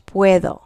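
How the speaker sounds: puedo said pweh-tho, D softened to a TH. Shown as a demonstration. Teacher modelling puedo for students, D like TH. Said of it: "like a T H".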